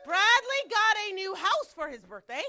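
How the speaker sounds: loud, high mock-excited proclamation; booming joke announcement; joking announcement. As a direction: announce it big, as a playful tease. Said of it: "No, I'm joking".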